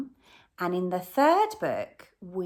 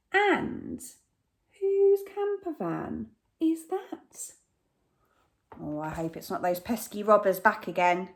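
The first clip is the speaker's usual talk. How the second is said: plain reading voice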